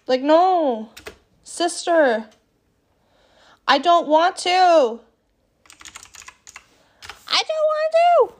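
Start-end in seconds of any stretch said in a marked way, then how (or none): 0.1-2.3 whiny protest. Real, not acted. Whining, refusing to clean. 7.3-8.3 squealed far higher, whiny. Real, not acted. Second take, high squeal.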